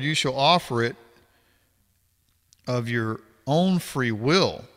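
measured, formal Bible reading voice; scripture reading. Acted. Reading Leviticus aloud to viewers, formal scripture reading. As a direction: read the verses out steadily, like a passage at church.

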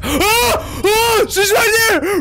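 high, loud panicked scream; terrified scream. Real, not acted. Gamer spotting the granny monster, terrified scream. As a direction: shriek at the monster in real fear.

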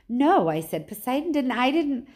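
high, loud, urgent denial; alarmed protest. A narrator's reading. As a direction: burst in to deny the accusation. Accused boy interrupts in protest.

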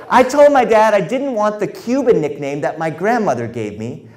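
ordinary talk, nothing marked

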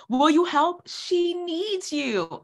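ordinary talk, nothing marked

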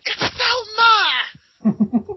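high, drawn-out screamed correction; over-the-top piercing yell, imitating a wrestler; mock outraged shriek. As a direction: shriek it in exaggerated outrage, stretching it out. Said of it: "and McCool is like".

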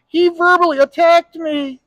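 high, whiny tattling voice; whiny complainer. Retold or imitated. Sensitive customer running to manager, high whiny tattling. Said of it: "so soft and so sensitive".

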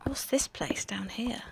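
soft slow whisper. Pretend. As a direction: whisper it into the dark cave. Whispering in cave.

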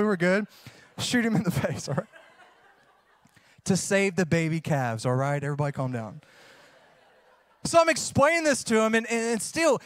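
usual speaking voice

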